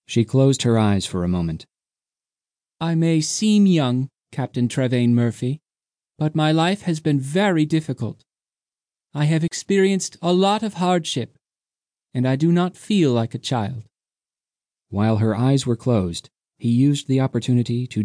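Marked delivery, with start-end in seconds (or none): 2.8-13.8 young woman voiced. A narrator's reading. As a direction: speak from old hardship, sincerely. Undina voiced, serious.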